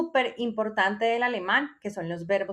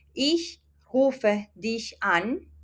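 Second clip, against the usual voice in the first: slow German example; much slower than usual. Shown as a demonstration. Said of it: "teacher reads German example".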